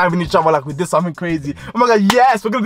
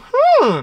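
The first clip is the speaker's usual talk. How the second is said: squeaky hmm; 4 dB louder than usual. Retold or imitated. Squeaky thinking hum.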